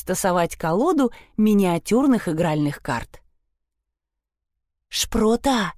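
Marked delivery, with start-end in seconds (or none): none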